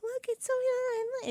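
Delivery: put-on little girl. Storyteller voices the little sister delighting in the ladybug, high and slow. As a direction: play a tiny girl showing off a bug.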